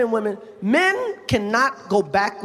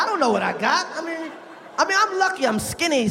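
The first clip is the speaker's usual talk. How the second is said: usual speaking voice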